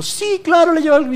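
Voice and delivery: mock the pious advice; high sarcastic "sure, of course".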